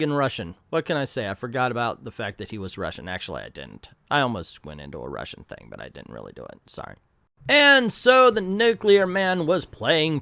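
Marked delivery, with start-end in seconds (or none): 3.1-6.6 dropped to a near murmur; quiet aside. Real, not acted. Confessing aside. 6.7-7.0 softly mumbled apology; quiet sorry. Real, not acted. Sheepish aside apology.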